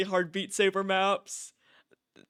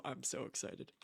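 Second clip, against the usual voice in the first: much softer, breathless thrill; hushed excitement; 5 semitones lower, 15 dB quieter than usual. Real, not acted. Streamer to chat before the trailer, hushed and excited. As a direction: let it slip out under your breath.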